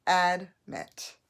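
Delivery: "Admit" as two clear syllables, ad then mit; firm ad, then mit in "Admit"; full vowel, separated. Shown as a demonstration. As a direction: say "Admit" with a full short a and a gap. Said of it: "So, but it's admit".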